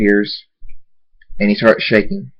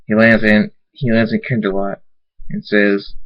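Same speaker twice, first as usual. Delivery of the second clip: ordinary delivery, nothing marked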